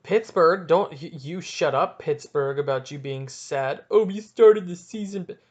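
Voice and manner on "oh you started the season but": louder, higher mock fan voice; hammed-up imitation of complaining; mocking complaint. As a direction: parody a sad fan's excuse.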